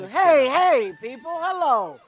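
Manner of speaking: shouted hello to the crowd; loud greeting. Real, not acted. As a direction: call out over the cheering.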